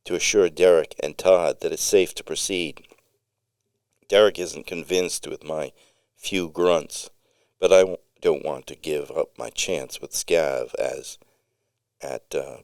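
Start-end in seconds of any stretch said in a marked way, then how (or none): none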